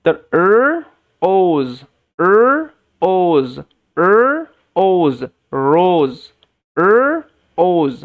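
loud, stretched r with tongue pulled back in আর and ওজ; drilling r sounds. Shown as a demonstration. Teacher drilling students on the English r in rose. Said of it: "জিভটা একটু পিছনের দিকে টেনে উচ্চারণটা করতে হবে".

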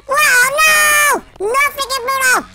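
high, loud cry of protest; loud high outburst; frustrated shout. Real, not acted. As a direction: yell at Bruno in dismay. Bruno catches him.

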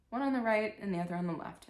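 usual speaking voice

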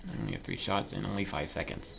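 normal talking voice

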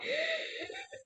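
sharp high gasp; anxious little gasp; overwhelmed gasp. Acted. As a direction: gasp like the brain shorts out. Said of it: "my brain hurts".

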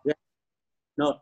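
ordinary delivery

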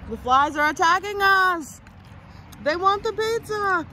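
high, slow playful alarm; mock alarm. Real, not acted. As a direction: sound dramatically under attack. Flies swarming the pizza.

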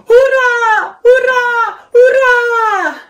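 high, drawn-out hurrahs at full voice; three big party cheers; loud cheering. A narrator's reading. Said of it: "riepen ze". (luid en hoog juichend)

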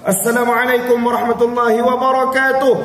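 loud, high call to a school assembly; loud greeting. Retold or imitated. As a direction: greet the whole hall loudly. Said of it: "pi bagi ceramah kat sekolah-sekolah".

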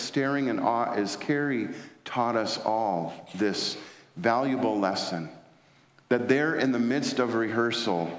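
usual speaking voice

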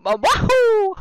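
very high, loud wahoo; Mario impression. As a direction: squeal it like the plumber leaping. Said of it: "See, I'm Mario".

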